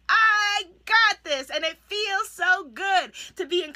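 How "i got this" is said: loud, slow, firmly declared; confident declaration. Shown as a demonstration. Coach showing how to declare an affirmation, loud and slow. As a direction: plant your feet and own it. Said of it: "declare it and mean it".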